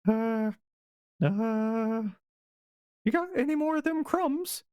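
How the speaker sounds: high squeaky mouse angling for food; hungry mouse. Acted. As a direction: squeak out a hopeful little request. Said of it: "not missing the chance for a meal".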